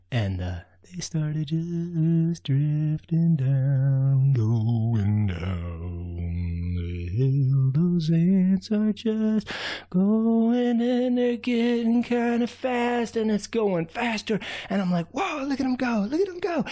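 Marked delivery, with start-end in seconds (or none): 0.9-12.0 drawn out. A narrator's reading. Slowed storytelling of sled ride. 15.1-16.6 high, thrilled cheer; excited cheering. Acted. Raccoon cheering as the ants race downhill, excited.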